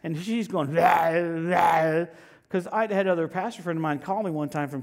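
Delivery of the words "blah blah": loud mock-possessed babble; possessed woman. Possessed woman ranting at the preacher, loud shouted babble. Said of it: "act like she's possessed with the devil".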